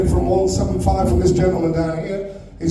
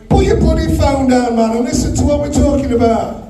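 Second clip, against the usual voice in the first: raised, higher-pitched scolding from the stage; exasperated telling-off; 5 semitones higher than usual. Real, not acted. Auctioneer to a bidder on his phone, exasperated. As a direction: call the man out for not listening.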